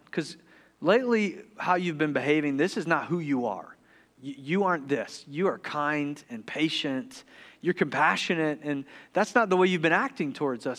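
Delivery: hushed, caring dad at bedtime; gentle fatherly concern. Retold or imitated. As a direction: reason quietly with a troubled child. Father gently confronting his daughter at bedtime.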